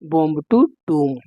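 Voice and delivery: slow "tomb" as toom, silent b; correct pronunciation. Shown as a demonstration. Teacher modelling the phrase for students, "tomb" as toom. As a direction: say "tomb" slowly, ending on m. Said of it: "correct pronunciation जो है वो है टूम".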